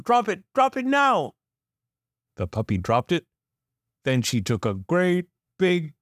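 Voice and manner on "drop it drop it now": yelled. A narrator's reading. Bobby yelling at the puppy to drop the toy. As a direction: bark the order at the dog. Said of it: "Bobby yelled".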